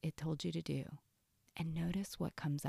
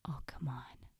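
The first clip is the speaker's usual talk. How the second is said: soft, hushed inner tempter; very quiet, sneaky; 3 semitones lower, 7 dB quieter than usual. Acted. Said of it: "It's usually very quiet".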